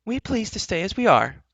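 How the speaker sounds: put-on high, loud, defiant young heroine; defiant cry. Heroine refusing the enchantress, crying out defiantly. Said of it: "cried Elenwy".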